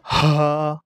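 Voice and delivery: হা pressed from the throat, squeezed; squeezed Arabic Ha. Shown as a demonstration. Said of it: "এটা কিন্তু চিপে বলতে হবে".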